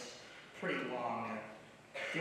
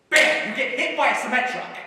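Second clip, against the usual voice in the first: loud, high burst of alarm; sudden shout; 7 semitones higher, 11 dB louder than usual. Acted. Friend picturing a sudden death, shouting. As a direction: slam the words out like an impact. Dramatic imagined accident.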